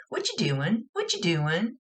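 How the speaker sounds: put-on playful child voice; little kid. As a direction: ask like a pestering little sister. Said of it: "sounds like something that the little kid would say".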